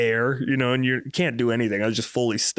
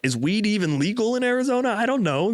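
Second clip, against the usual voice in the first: retold panic; 10 semitones higher than usual. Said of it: "start panicking".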